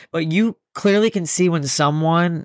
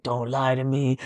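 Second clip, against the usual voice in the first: a soft, low threat in a put-on voice; mock menacing beast; 3 semitones lower, 5 dB quieter, slower than usual. Galaxy beast warning the speaker, low and quiet. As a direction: lean in quietly like a monster.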